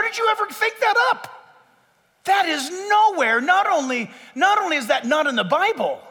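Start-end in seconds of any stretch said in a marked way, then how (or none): none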